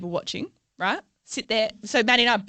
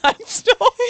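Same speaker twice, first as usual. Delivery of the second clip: high squeal of laughter on stalked; laughing confession; 11 semitones higher than usual. Real, not acted. Admitting to her co-host she stalked them, laughing. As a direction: confess it through a giggle.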